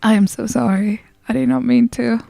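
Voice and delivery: ordinary delivery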